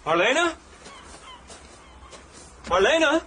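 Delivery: high, questioning call; uncertain calling. Acted. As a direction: call her name, rising, unsure she will answer. Calls the motionless woman, no answer.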